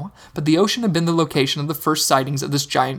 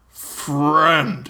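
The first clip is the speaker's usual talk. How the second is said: dim slow booming giant. Acted. The giant speaks.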